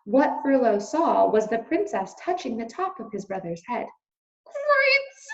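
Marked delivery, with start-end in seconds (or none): 4.4-5.3 high squeaky mouse shout; a startled squeak of shock; high squeaky mouse exclamation; alarmed shout. Acted. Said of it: "shouted Furlough again".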